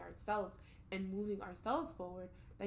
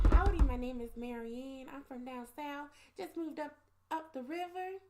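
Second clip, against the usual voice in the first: put-on Southern accent, pitched up; 5 semitones higher, 4 dB louder than usual. Shown as a demonstration. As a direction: play a Southern character with a higher voice. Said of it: "I gotta hear the Southern accent now".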